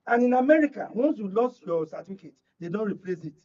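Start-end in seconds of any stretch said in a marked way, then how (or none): none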